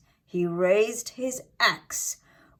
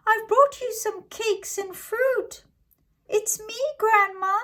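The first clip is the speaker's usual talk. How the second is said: put-on wolf faking a girl. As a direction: squeak like a girl to fool Grandma.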